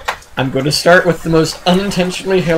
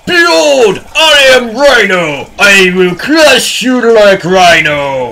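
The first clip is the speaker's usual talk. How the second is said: booming, drawn-out villain proclamation; loud, slow supervillain roar, announce yourself grandly; Rhino; 4 semitones higher, 7 dB louder, slower than usual. Retold or imitated. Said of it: "lifts the helmet, goes".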